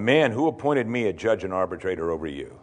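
low, quiet displeasure; acted stern rebuke, dropped low and soft; not too happy. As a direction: push back at the man, low and unimpressed. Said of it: "didn't sound too happy with that".

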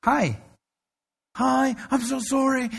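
imitating a frantic, apologetic caller; flustered caller. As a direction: gush out the apology like a panicked young woman. Frantic friend calls the pastor.

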